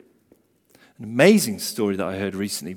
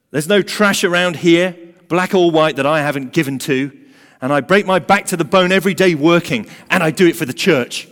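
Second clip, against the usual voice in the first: higher, louder indignant ranting; angry rant; 6 semitones higher, 6 dB louder than usual. A narrator's reading. Woman ranting at God about her charity, loud indignant rant. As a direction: list your good deeds at God in outrage. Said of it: "ranting out loud at God".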